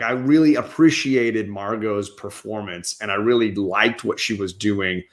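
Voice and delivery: ordinary delivery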